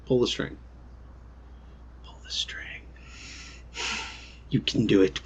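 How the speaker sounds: ordinary talk, nothing marked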